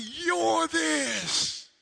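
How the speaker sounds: high, loud retort at the hecklers; angry shout back. Retold or imitated. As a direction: yell it right back at them. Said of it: "And I'm like".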